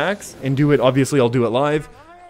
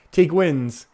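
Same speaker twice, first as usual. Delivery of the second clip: usual speaking voice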